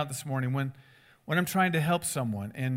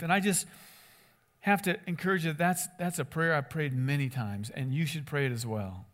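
usual speaking voice

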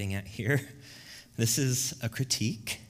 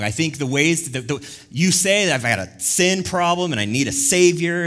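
loud indignant tirade, building higher; arguing back at God; 3 semitones higher, 8 dB louder than usual. Acted. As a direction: bark back in acted outrage, hammering the accusations. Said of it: "bark back, disagree".

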